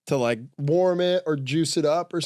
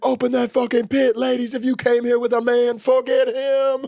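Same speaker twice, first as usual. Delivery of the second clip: louder, much higher crowd hype; hype man shout; 8 semitones higher, 5 dB louder than usual. Acted. Hype man firing up women in the crowd, loud and high. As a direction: rile up the pit.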